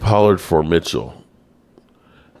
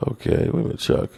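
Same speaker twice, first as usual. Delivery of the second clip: hushed; 9 semitones higher, 7 dB quieter, faster than usual. Real, not acted. Hushed aside to chat.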